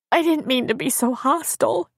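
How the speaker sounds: high tearful apology; weeping. A narrator's reading. Crying woman apologises to friend, weeping. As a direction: whimper it with acted remorse. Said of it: "Abby wept".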